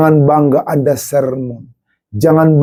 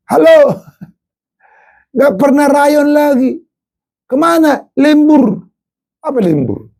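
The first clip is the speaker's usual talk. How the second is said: fiery preaching; 9 semitones higher than usual. Acted. Pastor scolds ungrateful churchgoers: fiery raised preaching. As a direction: preach it hard at the congregation.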